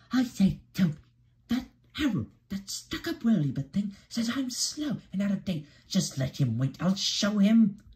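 high, indignant little engine; Percy fuming. A narrator's reading. Percy rants to Toby about the helicopter, fuming. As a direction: complain crossly in a high character voice. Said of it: "still fuming".

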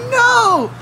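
loud, high, drawn-out protest; mock-outraged no. Texan rebuts a slight to H E B, mock-outraged. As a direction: blurt it out in exaggerated disbelief.

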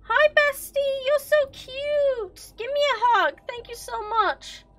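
high, drawn-out squeal; over-sweet squealed greeting; mock gushing. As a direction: gush in a loud high voice, stretching the words. Gushing at a game character.

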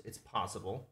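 ordinary talk

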